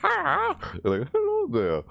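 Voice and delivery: very high, squeaky greeting; tiny creature says hi; squeaky creature hello. Retold or imitated. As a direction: chirp hello like a little game critter. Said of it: "saying hello in their own way".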